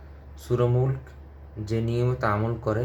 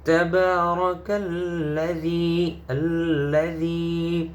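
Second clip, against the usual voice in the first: higher, slow tilawah of the phrase; melodic recitation; 6 semitones higher, much slower than usual. Shown as a demonstration. Teacher modelling the joined verse, chanted higher. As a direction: sing it out like a qari. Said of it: "teacher models recitation".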